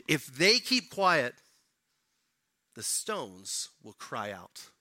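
slow, deliberate pronouncement; slow, weighty reply. Retold or imitated. Jesus answering the Pharisees, slow and weighty. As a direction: let each word land slowly. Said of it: "he replied".

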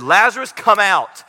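commanding call. Retold or imitated. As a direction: call Lazarus out with authority. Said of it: "He said".

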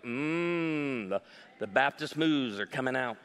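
low, wry comment on your own dancing; a rueful aside to yourself, dropped low; self-mocking. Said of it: "Something like".

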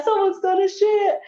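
broadcast the smell to the whole toilet; announcing girl. Retold or imitated. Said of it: "She's like".

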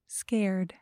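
ordinary delivery

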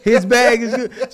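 high, loud mock clerk with a laugh; hammed-up clerk voice, laughing; imitating the clerk again. Telling a friend how the desk lady sounded, laughing. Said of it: "Was she talking like".

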